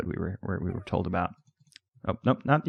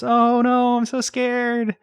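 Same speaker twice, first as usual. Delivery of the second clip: hammed-up fright, much higher and put-on; mock scared; 4 dB louder, much slower than usual. Teasing the sleepy ghost pet with a higher, mock-terrified squeal. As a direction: pretend to be terrified in a squeaky, sarcastic way.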